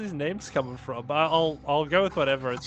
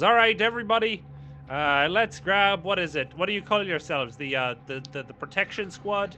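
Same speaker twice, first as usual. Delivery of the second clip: captain addressing crew; 5 semitones higher, 6 dB louder than usual. Acted.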